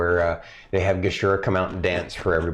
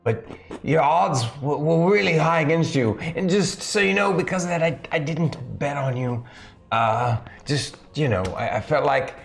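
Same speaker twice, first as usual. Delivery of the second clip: lightly voiced Mister Jock in a put-on English accent, higher; 4 semitones higher than usual. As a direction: play the gloating bookie in a mild English accent. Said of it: "I was doing the English accent".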